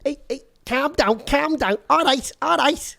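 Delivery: Scouse impression, calm down calm down; Liverpool accent. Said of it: "Do the Liverpool accent, please".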